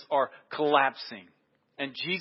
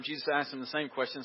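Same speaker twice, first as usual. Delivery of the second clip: ordinary delivery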